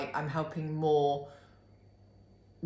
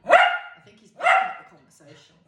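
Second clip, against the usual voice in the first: much higher and louder; 13 dB louder than usual. Real, not acted. Remarking on the dog in high baby talk. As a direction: chirp it up high.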